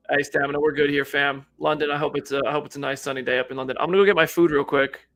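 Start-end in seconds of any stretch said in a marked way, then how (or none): none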